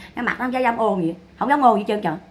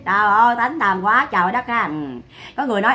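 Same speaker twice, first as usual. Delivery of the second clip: usual speaking voice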